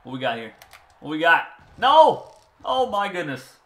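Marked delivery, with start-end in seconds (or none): 1.0-1.5 louder, sharper repeat of the question; alarmed shout. Real, not acted. 1.8-2.3 loud, high scream of alarm; real panicked yell; shouted no. Real, not acted. Play nearly goes wrong. 2.6-3.6 higher, breathless oh my goodness; shocked relief. Real, not acted. Relief after near disaster.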